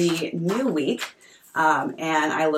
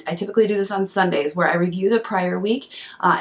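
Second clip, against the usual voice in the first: ordinary talk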